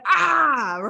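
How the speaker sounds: sudden high frustrated scream; a quick mock scream of frustration; demo scream. Shown as a demonstration. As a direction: let out a short strangled scream. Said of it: "you wanna scream".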